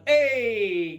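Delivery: ordinary talk, nothing marked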